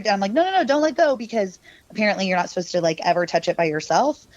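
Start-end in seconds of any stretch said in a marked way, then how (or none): 0.3-1.2 urgent plea. Retold or imitated. Urging cousin during séance.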